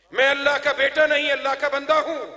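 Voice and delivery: ringing, insistent denial; emphatic declaration. Retold or imitated. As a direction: declaim it forcefully as the child Jesus. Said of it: "اور کیا کہتا ہے؟".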